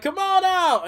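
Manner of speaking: high coaxing call. Retold or imitated. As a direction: sing it out to a pet. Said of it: "And then he's like".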